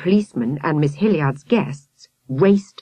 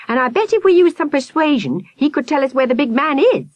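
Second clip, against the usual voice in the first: put-on eager boy; 10 semitones higher, 4 dB louder than usual. A narrator's reading. Peter eager suggestion.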